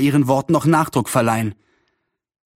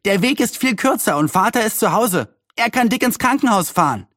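put-on high-pitched, eager boy; voiced boy's urgent suggestion, pitched up; neighbour boy calling; 8 semitones higher than usual. A narrator's reading. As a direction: pipe up with a helpful plan. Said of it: "rief einer der Nachbarjungen".

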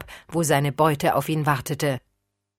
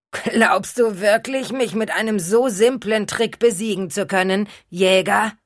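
menacing undead taunting the hunter; vampire voice; 4 semitones higher than usual. Acted.

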